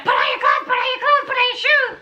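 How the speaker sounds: reliving the panic, pushing him to dress fast; panicked rushing. Retold or imitated. Girlfriend panicking, ordering boyfriend to dress, urgent and high. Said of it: "I started panicking and I'm like".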